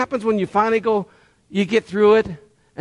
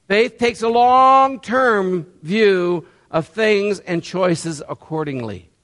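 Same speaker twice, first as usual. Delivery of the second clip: very slow, weighty delivery; slow emphatic; slower than usual. Shown as a demonstration. Preacher stressing a key line to the congregation, slow and weighty. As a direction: lay each word down slowly. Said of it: "slow solemn emphasis".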